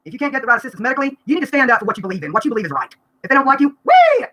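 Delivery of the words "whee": gleeful whoop. Acted. Dismissing doctors with a yelp.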